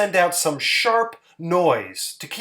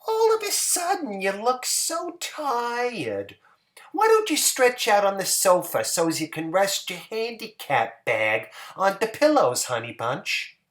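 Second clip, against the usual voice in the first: Hazel's voice, tender; 6 semitones higher than usual. Acted. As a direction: coax him gently to rest, high and sweet. Hazel's voice in story.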